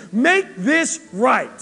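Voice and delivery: insistent demand. Retold or imitated. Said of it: "all we can see is".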